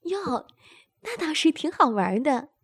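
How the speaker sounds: high and loud, quick delight. A narrator's reading. Woman hearing the plan, loud quick delight. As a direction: burst out gleeful and fast. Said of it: "陈娜高兴地说".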